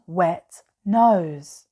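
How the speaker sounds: usual speaking voice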